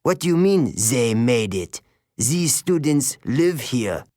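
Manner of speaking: a hint of French disdain for Grover; contemptuous. A narrator's reading. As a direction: sneer at the student. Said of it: "he detested Grover".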